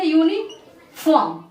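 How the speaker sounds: "यूनिफॉर्म" said very slowly, syllable by syllable; slow model word. Shown as a demonstration. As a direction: spell out "यूनिफॉर्म" slowly for the class. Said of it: "क्या बोलेंगे".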